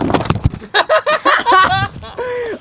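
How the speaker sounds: bursting out laughing; big real laugh; loud laughter. Real, not acted. Friend's Pepsi joke cracks him up.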